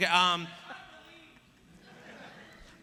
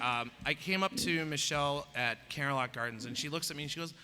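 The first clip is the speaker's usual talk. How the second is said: ordinary talk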